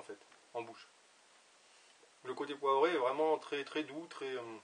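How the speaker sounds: talking around pipe stem; pipe in mouth. Real, not acted. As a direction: lips gripping pipe. Seen 3: Pipe stem between lips from 3.7s; earlier only held near mouth.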